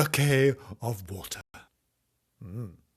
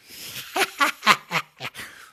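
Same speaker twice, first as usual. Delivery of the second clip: high staccato cackle; pitched-up wicked laugh; old witch cackle; 10 semitones higher, 4 dB louder than usual. Acted. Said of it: "a bit of a cackle at the end".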